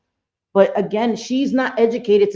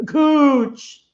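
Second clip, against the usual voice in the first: usual speaking voice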